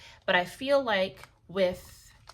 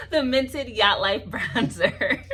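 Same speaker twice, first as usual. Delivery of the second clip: slow, louder, laughing delivery; laughing correction; 2 semitones higher, 5 dB louder, much slower than usual. Real, not acted. Vlogger finally getting the product name right, laughing. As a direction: get the name out through laughter.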